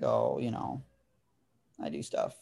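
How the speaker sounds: mock cryptic. Telling the girl what he does, cryptic and evasive. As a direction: play it coy and mysterious, dropping the pitch. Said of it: "super cryptic".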